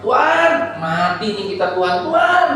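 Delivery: high, loud cry for rescue; acted panic, voice climbing sharply on the name; panicked disciple. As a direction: call out as if about to drown. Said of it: "muridnya panik".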